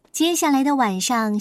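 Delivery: ordinary delivery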